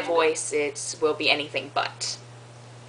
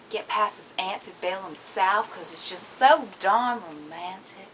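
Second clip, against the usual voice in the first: put-on soft Southern Belle Georgia accent; Georgian drawl; 6 dB quieter than usual. Southern Belle sighing to Humphrey, soft Georgia drawl. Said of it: "this nice little Georgian drawl".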